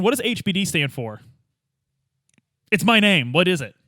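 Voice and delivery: usual speaking voice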